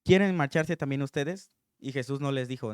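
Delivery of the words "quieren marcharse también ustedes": louder, blunt, no softening; blunt challenge. Acted. Jesus to the disciples, louder and blunt. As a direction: throw it out as a hard challenge. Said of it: "Les dice".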